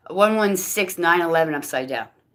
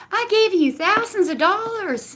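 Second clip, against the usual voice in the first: mock message voice. Reading fake message in voice.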